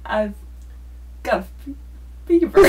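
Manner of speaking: Christopher Walken impression. Guest coaches host's Walken, fever leaps up. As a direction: pop the word fever like Walken. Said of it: "We're gonna do Christopher Walken".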